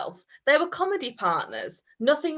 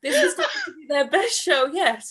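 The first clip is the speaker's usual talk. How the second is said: high, loud, laughing through words; cracking up; 13 semitones higher, 7 dB louder than usual. Real, not acted. Reader asked if he is okay, laughing. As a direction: lose it and keep reading. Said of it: "Are you okay, Bobby?".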